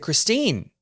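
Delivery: high hollered name across the locker room; calling out. Retold or imitated. Guest hollers to Christine in the women's locker room, high call. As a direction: call her name out to reach the other room.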